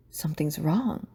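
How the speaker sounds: hushed, anxious whisper; worried whisper. Acted. Hermione whispers her worry to herself. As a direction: breathe it out in fear.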